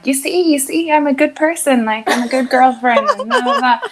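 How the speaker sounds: mock desperate self-promotion; over-the-top eager. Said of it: "going over the top with being like".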